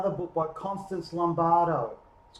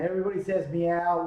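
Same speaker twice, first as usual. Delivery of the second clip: ordinary delivery, nothing marked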